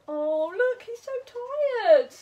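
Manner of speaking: plain reading voice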